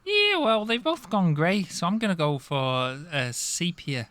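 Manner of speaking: put-on Liverpool Scouse accent.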